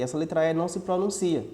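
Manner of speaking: usual speaking voice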